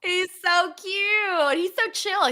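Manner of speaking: high, drawn-out gushing over a cute dog; cooing. Real, not acted. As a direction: coo it high and stretched.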